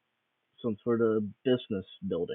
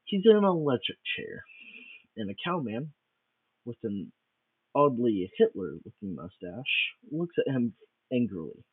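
ordinary delivery

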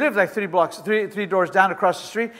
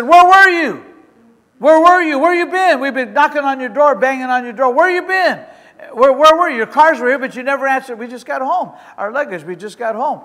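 higher, louder alarmed neighbors; neighbors' alarmed questions; 5 semitones higher, 6 dB louder than usual. Retold or imitated. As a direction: voice the worried neighbors at the door. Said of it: "They said".

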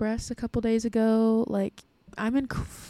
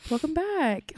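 ordinary delivery

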